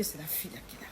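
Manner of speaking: whispered aside, unvoiced. Real, not acted. Whispering to the listener about the suspected rival. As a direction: breathe it like a secret suspicion. Private suspicion told aside.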